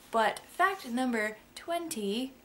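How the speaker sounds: ordinary delivery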